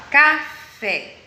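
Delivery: "café" dividido em sílabas, com "fé" mais forte; ênfase em FÉ. Shown as a demonstration. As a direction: say "café" syllable by syllable, hitting "fé". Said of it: "a sílaba mais forte dela é o fé". ("café" com a última sílaba destacada)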